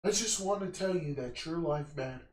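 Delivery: soft, low mock-earnest old man; imitated old guy. Old guy taps a stranger's shoulder in Vegas, imitated soft solemnity. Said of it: "I see this old guy".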